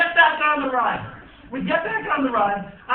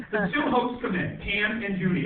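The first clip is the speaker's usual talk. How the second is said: ordinary talk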